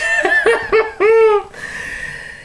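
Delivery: high-pitched maniacal villain cackle; sudden high stage laugh; Joker laugh. Acted. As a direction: cackle high like a mad clown. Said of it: "my Mark Hamill impression".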